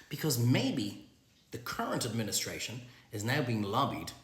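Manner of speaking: usual speaking voice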